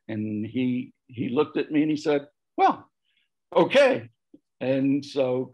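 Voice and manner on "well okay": much higher, louder reply; acted relenting. Priest accepting his offer to be student, acted relenting. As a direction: give in on a high-pitched, imitated okay.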